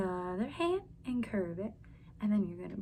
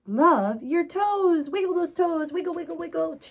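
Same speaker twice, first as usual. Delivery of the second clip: put-on read to children; 5 semitones higher, 10 dB louder than usual. A narrator's reading. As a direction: read it big for little listeners. Expressive read-aloud for children.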